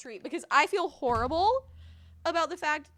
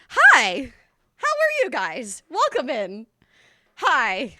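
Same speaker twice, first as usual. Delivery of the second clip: ordinary delivery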